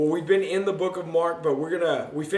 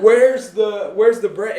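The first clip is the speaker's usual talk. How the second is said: loud, high frantic cry about bread; hammed-up hungry outburst; mock panic; 4 semitones higher, 10 dB louder, slower than usual. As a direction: play the hungry disciples hunting for food. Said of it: "They're like".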